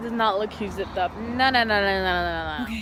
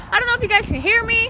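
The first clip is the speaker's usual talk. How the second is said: loud; 10 semitones higher, 9 dB louder, faster than usual. Real, not acted. Vlogging outdoors, checking mic.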